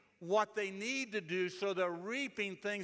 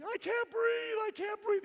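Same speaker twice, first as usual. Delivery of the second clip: much higher, louder mock child shriek; screaming little girls; 5 dB louder than usual. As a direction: squeal like a panicked little niece. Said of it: "They would be screaming and yelling".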